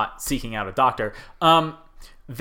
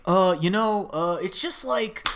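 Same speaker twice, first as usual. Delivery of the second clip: drawn-out, higher-pitched dude voice; surfer dude; 6 semitones higher, slower than usual. Retold or imitated. Quoting a doctor from a YouTube video, surfer dude impression. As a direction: do a laid-back surfer dude, stretching the words. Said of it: "sounded like a surfer dude".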